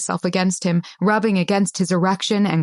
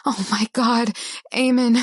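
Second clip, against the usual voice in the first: a touch higher, a light whimper; 4 semitones higher than usual. A narrator's reading. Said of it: "I whimpered".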